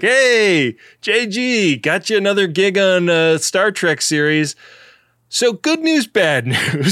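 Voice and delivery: loud, high-pitched agent's hail; hammed-up agent pitching a gig to a client; talent agent. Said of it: "His agent is like".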